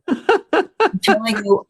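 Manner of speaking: said through a real laugh, slowly; giggling while telling it. Real, not acted. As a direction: still laughing at her own joke. Laughing over the rent joke.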